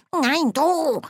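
high-pitched dwarf squabbling comment; muttering dwarf. A narrator's reading. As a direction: pass the buck in a high dwarf voice. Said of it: "Leise Kommentare waren zu hören".

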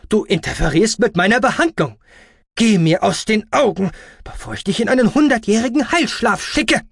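high, loud threat; furious high-pitched doctor; nagging screech. Acted. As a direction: berate him, building to a threat. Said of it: "keifte der Ara".

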